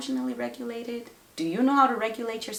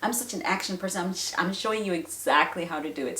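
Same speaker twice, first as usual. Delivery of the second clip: ordinary delivery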